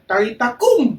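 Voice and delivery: put-on loud, high call to the dead child; commanding Jesus. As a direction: call her back to life. Jesus raises the girl.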